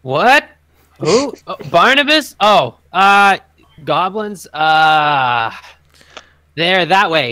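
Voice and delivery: startled guard, high and loud; a confused guard stumbling for words; dim guard. Acted. Guard calls down to the adventurer, confused and loud. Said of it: "and he goes".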